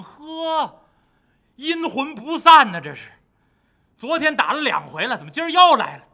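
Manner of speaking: loud, exasperated monster voice; annoyed monster. Acted. River monster irritated that Bajie is back again, loud. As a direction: complain like a fed-up brute.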